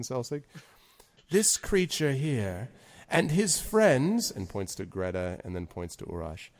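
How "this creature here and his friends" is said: slow, raised-pitch stately lord; single them out slowly and grandly; Zephyros. Acted. Lord vouching for the creature and his friends, slow and stately. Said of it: "lowers his voice and says".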